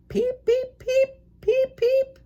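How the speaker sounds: tiny, very high chick peeps; baby chick peeping. Acted. Chick in the egg calling out to its fathers, high peeping. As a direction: peep like a chick inside its egg. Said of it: "it said".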